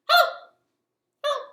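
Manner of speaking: quick clipped hellos; fast hello. Shown as a demonstration. Host shows kids the fast hello. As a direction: fire them off as fast as possible. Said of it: "as fast as we can".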